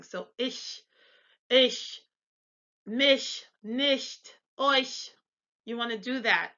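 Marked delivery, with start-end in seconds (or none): 0.4-5.1 ish, mich, nicht, euch with ch exhaled like a whistle; soft German CH. Shown as a demonstration. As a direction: separate examples ish, mich, nicht, euch, tongue to the palate. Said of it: "exhale like you're whistling".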